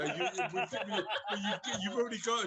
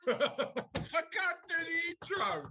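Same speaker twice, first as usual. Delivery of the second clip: high, slow words through laughter; real corpsing, voice shooting high; helpless laughter; 9 semitones higher, much slower than usual. Real, not acted. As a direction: give up mid-line, cracking up. Corpses during the role play.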